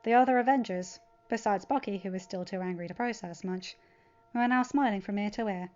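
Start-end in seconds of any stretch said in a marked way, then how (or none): none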